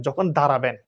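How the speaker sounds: usual speaking voice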